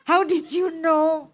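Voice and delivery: high-pitched teasing astonishment; mock surprise. Listener replies to a self-declared bore, mock surprise. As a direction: act amazed, pitch jumping up.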